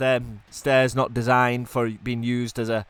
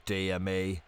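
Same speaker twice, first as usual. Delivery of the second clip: usual speaking voice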